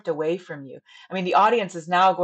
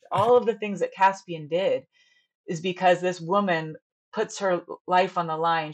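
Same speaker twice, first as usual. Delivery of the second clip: ordinary talk, nothing marked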